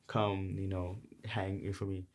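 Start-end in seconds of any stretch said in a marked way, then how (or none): none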